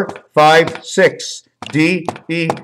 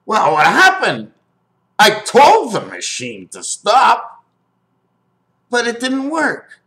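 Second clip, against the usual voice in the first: high put-on voice, swooping in mock dismay; hammed-up bewilderment, pitched way up; baffled comic character; 8 semitones higher than usual. Acts out comic skit after ending.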